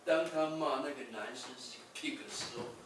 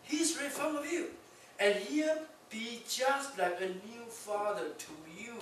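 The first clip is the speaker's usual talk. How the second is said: a hint of a mother reassuring, pitched higher; motherly tone; 8 semitones higher, 3 dB louder than usual. Etsuko soothing the girl like a mother. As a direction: coax her like a mother would. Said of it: "这不是妈妈的口吻吗".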